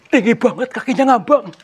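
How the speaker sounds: high, loud frightened cry; alarmed exclaim. Real, not acted. Ghost hunter sees the floating feet, alarmed cry. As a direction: shout it in alarm.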